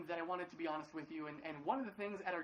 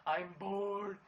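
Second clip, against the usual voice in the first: put-on slow, drawn-out bored kid voice, louder; whiny son; 5 dB louder, much slower than usual. Dad imitating his bored son for the congregation, whining. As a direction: whine it out like a restless little boy. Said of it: "he's been like".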